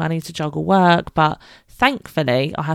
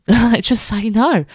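laughing; 6 semitones higher, 4 dB louder, slower than usual. Real, not acted. Laughing at husband's advice.

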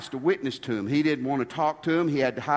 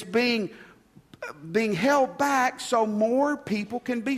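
fervent preaching; 7 semitones higher than usual. Acted. Preaching at full force.

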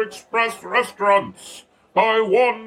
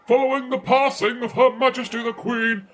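usual speaking voice

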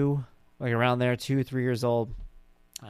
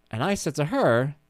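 ordinary talk

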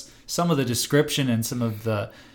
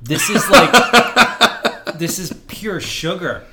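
laughing in disgust; 4 semitones higher, 6 dB louder than usual. Real, not acted. Tasting a bad beer.

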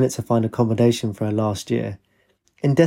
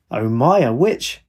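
playful mock amazement, pitch swooping up; humorous cry; 5 semitones higher than usual. A narrator's reading. Father greeting a girl dressed as a witch, humorous cry. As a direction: exclaim it in jest, pitch jumping on the question. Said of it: "he cried humorously".

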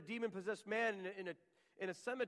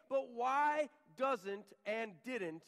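slow, drawn out; 4 semitones higher, 6 dB louder, much slower than usual. Acted. Preacher stretching the question.

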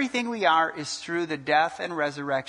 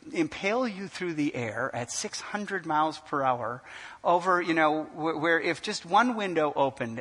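usual speaking voice

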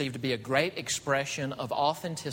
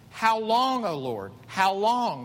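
louder, higher cry of how long; lament cry; 5 semitones higher, 4 dB louder, slower than usual. Acted. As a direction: cry out to God in anguish. Voicing psalmist's lament cry.

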